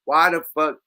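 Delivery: ordinary talk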